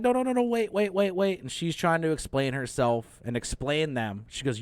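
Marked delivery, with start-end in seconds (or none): 0.0-1.4 higher, rushed mock pleading; pleading panic. Girl begs him not to strike, high and pleading. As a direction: beg him to stop. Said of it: "And she's like".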